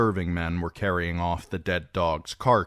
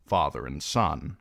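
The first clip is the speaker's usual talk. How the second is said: plain reading voice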